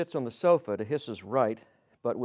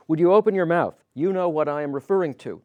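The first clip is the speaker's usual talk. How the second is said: louder, insistent retold demand; demanding loudly; 4 semitones higher, 10 dB louder than usual. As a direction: push hard, mouth open or else. Hiss demanding at the confrontation.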